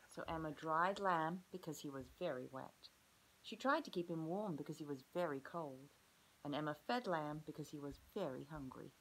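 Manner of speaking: clear, even storybook reading; reading to children. A narrator's reading. Teacher reading a picture book to her class, storybook voice. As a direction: read the book aloud to the class.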